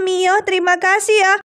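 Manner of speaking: ordinary talk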